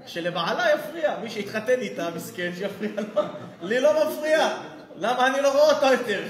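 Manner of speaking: loud, laughing mockery of the husband's answer; hammed-up laughing retort; mocking laugh. Preacher mocks a lax husband, laughing loudly.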